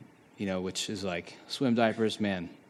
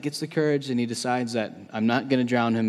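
ordinary talk

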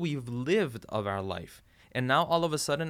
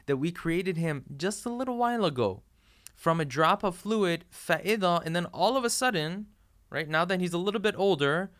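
higher and louder, pressing the point; fervent preaching; 5 semitones higher, 3 dB louder than usual. Real, not acted. Preacher driving home human arrogance, louder and higher. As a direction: preach it with rising force.

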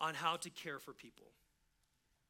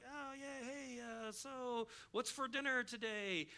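awkward dodge; 4 semitones higher, 4 dB quieter, slower than usual. Pretend. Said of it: "we're like, awkward with the brokenness".

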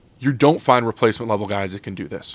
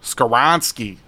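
Chicago accent; slower than usual. Shown as a demonstration. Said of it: "that's my Chicago accent I was trying".

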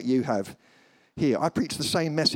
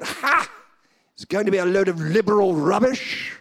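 loud, dismissive scoff; high "Ha!" then a loud sneer; scornful; 5 semitones higher, 5 dB louder than usual. Acted. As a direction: write it off with mock contempt. Acting out his scornful prejudiced thought.

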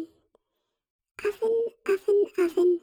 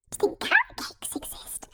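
whispering; 14 semitones higher, 7 dB quieter, faster than usual. Acted. Excited whisper about carrot cakes.

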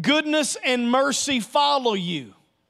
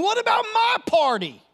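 whiny complaint; 11 semitones higher than usual. Retold or imitated. Brother's complaint voiced.

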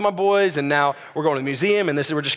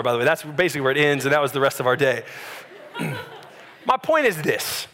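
usual speaking voice

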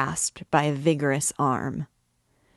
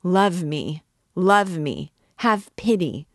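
desperate cry; 3 semitones higher, 6 dB louder than usual. A narrator's reading. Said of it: "cried the poor priest".

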